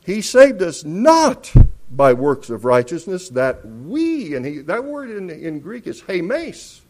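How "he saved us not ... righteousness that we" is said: verse quoted with forceful "not" and raised "we"; emphatic stress. Shown as a demonstration. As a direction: hit "not" loud, then lean on "we". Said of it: "It's emphatic".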